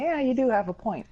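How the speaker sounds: high-pitched mock conceding; acted concession. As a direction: act out granting the other person their point. Acting out conceding in an argument.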